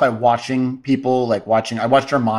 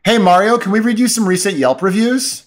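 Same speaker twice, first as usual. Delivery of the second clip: sarcastic manager; 7 semitones higher than usual. Pretend. Manager confronts chef.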